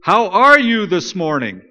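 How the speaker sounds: loud, slow, pointed greeting; said loudly. A narrator's reading. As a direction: call it out slowly and loudly to someone who won't answer. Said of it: "said loudly".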